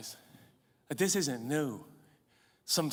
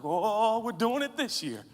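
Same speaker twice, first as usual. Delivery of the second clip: loud, higher, slowing triumphant cheer; gleeful boast; 7 semitones higher, 9 dB louder than usual. Retold or imitated. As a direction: crow about the packed chapel, rising to a shout. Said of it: "I'd be like".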